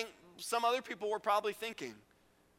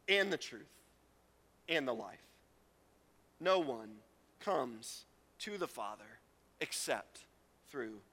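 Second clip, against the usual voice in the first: solemn scripture; 6 semitones lower than usual. Acted. Solemn reading of Jesus' words.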